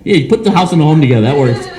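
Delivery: usual speaking voice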